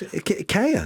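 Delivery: ikea as ih-KAY-a, pitched high; imitated pronunciation. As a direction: try out ih-KAY-a as a high, unsure question. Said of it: "what's she say it was?".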